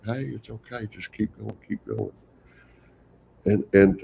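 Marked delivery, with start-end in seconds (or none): 0.1-2.1 soft, much quieter reassurance to a struggling teammate; gentle encouragement. Retold or imitated. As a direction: ease him along quietly, like a kind teammate. Encouraging a teammate having bad day.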